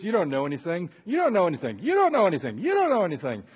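put-on childish tit-for-tat, climbing in pitch; back-and-forth bickering. Friends fighting back and forth, mock squabble. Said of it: "Back and forth".

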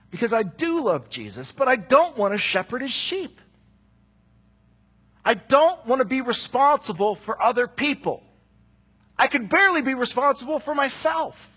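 high, loud, pleading confession; impassioned. Real, not acted. Preacher to congregation, impassioned high plea. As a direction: cry it out, torn inside.

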